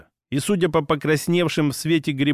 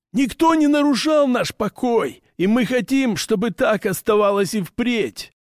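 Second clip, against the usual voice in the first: high orc voice leaning on "хотим"; orc voice, stress on хотим; 7 semitones higher than usual. Acted. Orc's first statement.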